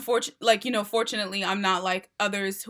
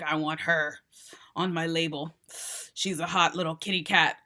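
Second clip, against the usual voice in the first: low, soft cigar-smoker impression; low-voiced jazz-bar talent scout; cigar-smoking older label boss; 5 semitones lower, 5 dB quieter than usual. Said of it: "Some cigar smoking, older, typically masked person".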